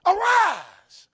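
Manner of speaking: Damsel called out high and loud; calling out. Retold or imitated. Jesus calling the dead girl, loud and high. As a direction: call Damsel out like a command. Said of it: "he called the name".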